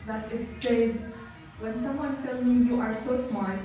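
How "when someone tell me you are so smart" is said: ordinary talk, nothing marked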